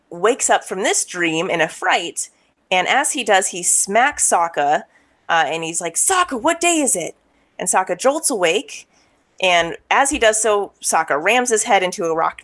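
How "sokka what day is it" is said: high, startled panicked voice. Retold or imitated. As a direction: ask it in a sudden fright. Panicked Aang wakes Sokka.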